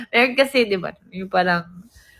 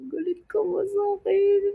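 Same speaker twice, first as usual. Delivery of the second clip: high, softer, plaintive question; whiny asking; 9 semitones higher, 5 dB quieter than usual. Retold or imitated. Speaker asking friend in the van, whiny. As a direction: imitate a small pleading voice.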